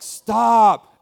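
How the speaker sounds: loud, firm single-word order; stern command. Retold or imitated. Jesus rebuking the storm, loud firm command. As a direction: bark it down like calming a storm. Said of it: "It's like".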